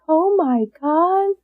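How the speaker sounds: high, soft, drawn-out astonishment; imitating a stunned reaction, slow; retold therapist's shock.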